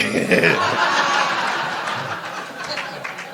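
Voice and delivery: long airy laugh, almost silent; breathy laugh. Real, not acted. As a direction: let out a soft wheezing laugh. Laughs at sponsor memory.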